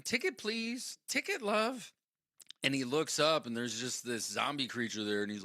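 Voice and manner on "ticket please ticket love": high-pitched imitation of a woman; woman ticket collector. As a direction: call for tickets in a put-on female voice. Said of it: "the woman comes up in his vision".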